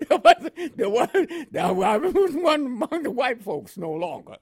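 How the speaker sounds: talking through laughter, high-pitched; helpless chuckle running into speech; laughing. Real, not acted. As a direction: laugh your way through the words. Laughs at his own remark.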